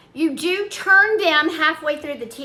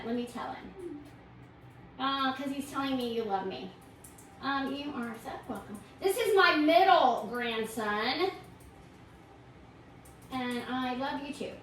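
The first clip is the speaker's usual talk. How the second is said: quiet aside to grandson; 2 semitones lower, 11 dB quieter than usual. Real, not acted. Grandma answering her grandson off to the side, softly. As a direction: speak softly to someone beside you.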